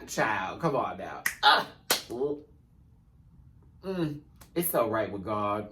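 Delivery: ordinary talk, nothing marked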